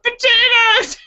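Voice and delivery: very high squealed yell; yelled punchline. Acted. Blonde giving herself away from inside the basket, squealed yell. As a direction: shriek it like a silly punchline. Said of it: "and she yelled".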